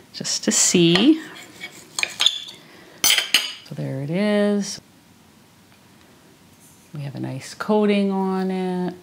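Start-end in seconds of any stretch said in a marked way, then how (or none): 0.1-7.5 soft unvoiced whisper. Real, not acted. Whispers while tasting.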